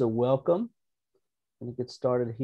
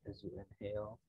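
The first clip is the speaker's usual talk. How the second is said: calm, unhurried meditation guide, growing softer; soft-spoken relaxation voice; guided meditation; 4 dB quieter than usual. Real, not acted. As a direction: settle listeners gently, leaving room to breathe. Leads an opening meditation.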